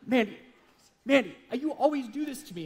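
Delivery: high, whiny-style mock protest; hammed-up wounded complaint; playing the victim. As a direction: plead like a wronged husband, pitch climbing. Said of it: "I started acting like a victim".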